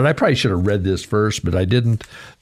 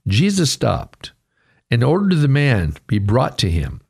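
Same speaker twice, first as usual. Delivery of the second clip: normal talking voice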